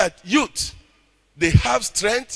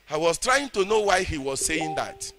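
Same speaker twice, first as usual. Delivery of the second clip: ordinary delivery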